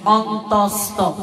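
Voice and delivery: অন্তস্ত slow and low, every syllable separated; slow careful অন্তস্ত; correct pronunciation. Shown as a demonstration. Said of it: "এটা হবে".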